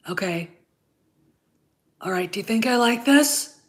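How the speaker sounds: ordinary delivery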